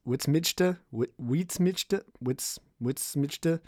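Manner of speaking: high, slow witsmidgte tried over and over; repeated fumbling tries. Retold or imitated. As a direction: stumble through witsmidgte again and again. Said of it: "I'd be like".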